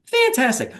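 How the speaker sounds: mock-gushing "Fantastic." with a falling end; sarcastic. Mocking the Tonal machine.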